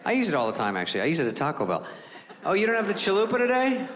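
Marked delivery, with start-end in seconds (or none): 2.4-3.9 higher-pitched put-out question; mock disappointed customer. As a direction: act out mild incredulity at the counter. Mock complaint at Taco Bell.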